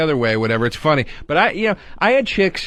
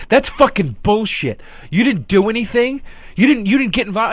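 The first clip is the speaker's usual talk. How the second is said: imitating her scoff, higher pitched; mock indignant date; 5 semitones higher than usual. Date calling out his story, mock indignant. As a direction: retell her outburst with raised pitch. Acted quote of a scornful date.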